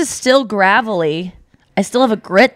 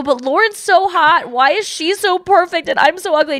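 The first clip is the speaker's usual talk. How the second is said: put-on whiny kid self; 8 semitones higher than usual. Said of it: "And then I'd go".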